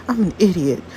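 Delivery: ordinary talk, nothing marked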